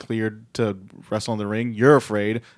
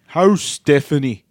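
slow, higher, louder attempt at Irish accent; thick botched accent; 4 semitones higher, 6 dB louder, slower than usual. Wrestler asks Triple H after Stephanie, exaggerated botched accent. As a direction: stretch it out in an overdone accent. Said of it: "It's more of a Nikki Cross".

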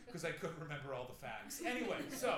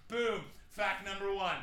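cocky speech giver; 4 semitones higher, 8 dB louder, slower than usual. Retold or imitated. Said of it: "he was just like".

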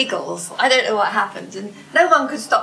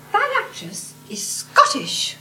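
knowing lady; 10 semitones higher, slower than usual. Retold or imitated. Lady's punchline voiced.

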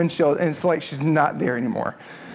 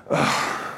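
imitated sigh; 5 dB quieter than usual. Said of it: "I hear this".

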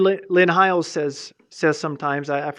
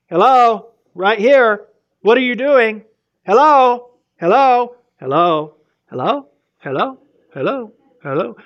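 high, loud, insistent scolding; hammed-up nagging voice; nagging Holy Spirit; 6 semitones higher, 8 dB louder than usual. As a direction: keep calling out to get his attention. Holy Spirit nagging after bad thought.

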